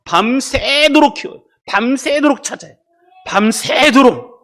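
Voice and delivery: 밤새도록 drawn out, high and emphatic; stress on 밤새도록. Real, not acted. Preacher on parents searching all night, 밤새도록 stretched out high. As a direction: stretch 밤새도록 slowly and insistently each time.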